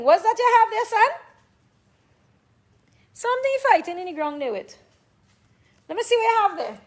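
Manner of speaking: high, coaxing voice to a dog; pet talk. Real, not acted. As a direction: coax the dog to show it. Asking the dog what it has.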